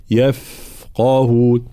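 "يفقهون" drawn out on a streaming f; flowing fa. Shown as a demonstration. Teacher showing sound flow, "يفقهون" with prolonged f. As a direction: hold the "ف" in "يفقهون", then heavy qaf. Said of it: "جريان الصوت حال سكون الحرف".

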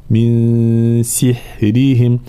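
very slow سحرهم with a light raa; سحرهم براء مرققة. Shown as a demonstration. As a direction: stretch سحرهم, keep the raa thin. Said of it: "كذلك مرققة".

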